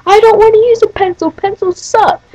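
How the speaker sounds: high mock tantrum; mocking, high-pitched kid protest; pissed-off kid. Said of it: "he's, like, getting all pissed".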